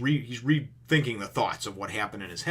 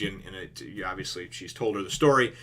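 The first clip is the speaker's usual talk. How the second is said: ordinary talk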